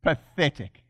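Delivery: a low, scornful "Pathetic"; contemptuous dismissal. Retold or imitated. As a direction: sneer it down at the devil. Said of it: "look at the devil and go".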